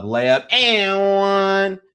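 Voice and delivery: high, loud, drawn-out and-one call; excited call. Acted. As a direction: stretch every word like a hype announcer. Calling a made basket.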